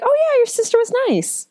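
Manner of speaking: high, bright fake politeness; fake polite. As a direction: chirp it with a fake smile.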